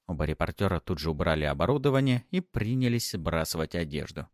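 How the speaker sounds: ordinary delivery, nothing marked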